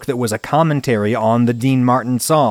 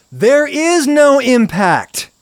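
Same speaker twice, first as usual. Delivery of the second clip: furious and exasperated, building; angry rant; 11 semitones higher, slower than usual. Real, not acted. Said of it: "before I make this angry point".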